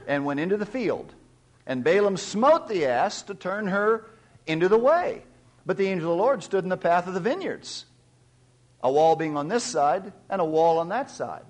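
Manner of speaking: scripture reading. Acted. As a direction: proclaim the passage to the congregation. Reads scripture aloud.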